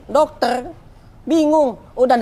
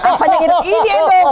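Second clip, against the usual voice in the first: loud, very high retold exclamation; high yell with laughter behind it; excited shout; 11 semitones higher, 6 dB louder than usual. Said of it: "Saya teriak".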